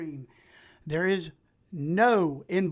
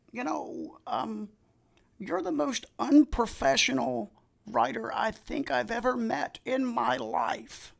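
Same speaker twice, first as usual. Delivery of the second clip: put-on old lady's blunt verdict; 5 semitones higher than usual. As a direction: lightly voice the old woman's insult. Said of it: "And she goes".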